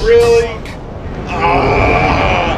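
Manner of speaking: loud, high incredulous yelp; outraged disbelief. Real, not acted. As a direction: cry out in disbelief. Killed by submarine.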